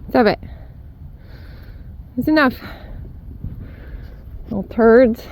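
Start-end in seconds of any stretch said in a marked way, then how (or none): none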